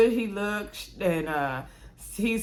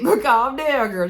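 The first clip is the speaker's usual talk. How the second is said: laughing calm down; 4 semitones higher, 7 dB louder, slower than usual. Real, not acted. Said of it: "But I get it".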